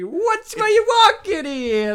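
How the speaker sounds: high, loud, slow Italian New Yorker; mock Italian New Yorker. Podcast host riffing on a name in Italian New Yorker accent. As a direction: ham up a street-corner Italian New Yorker. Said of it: "sounds like, like, a weird kind of".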